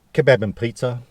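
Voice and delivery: ordinary delivery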